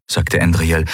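ordinary delivery, nothing marked

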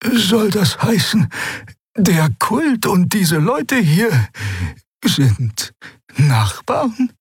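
high, slow, wondering question; an old woman piecing it together; curious old healer. Acted. Xea asking the others, high curious voice. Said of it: "reckte neugierig ihren Hals".

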